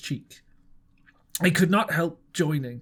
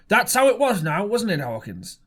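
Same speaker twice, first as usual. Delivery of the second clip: hearty, louder appeal; lightly voiced Silver; 4 semitones higher, 5 dB louder than usual. A narrator's reading. Silver appealing to Hawkins before the squire, hearty voice. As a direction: Silver seeking Jim's backing.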